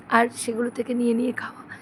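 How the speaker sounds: breathy whispered aside; whispering. Real, not acted. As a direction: let it drop to a whisper. Whispered aside about eating sweets.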